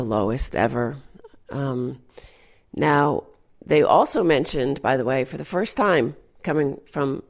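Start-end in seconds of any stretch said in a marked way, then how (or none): none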